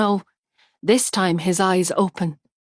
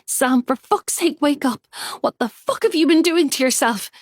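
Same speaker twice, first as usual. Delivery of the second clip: a raging, frightened yell; shouting; 7 semitones higher than usual. A narrator's reading. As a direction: shout at him in despair. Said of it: "she shouts".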